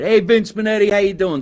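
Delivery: high, loud hello to a fan; loud, high greeting to a viewer on stream; excited shout-out. Real, not acted. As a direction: call out a big welcome.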